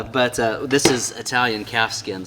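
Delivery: ordinary talk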